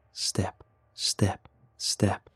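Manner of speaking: breathy whispered counting. Acted. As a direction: hush each step in a whisper. Sneaking away quietly.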